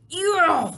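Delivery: loud high yuck of disgust; disgusted roar. Acted. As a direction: spit out a roar of revulsion. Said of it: "roared the blood bottler".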